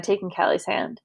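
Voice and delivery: usual speaking voice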